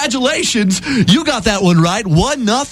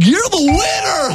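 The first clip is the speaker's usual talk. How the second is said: ordinary delivery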